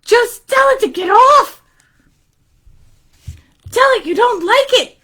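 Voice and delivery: put-on high, insistent kid voice; urging boy Aaron. Boy advising lady about hair, high and urging. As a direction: pipe up with eager advice.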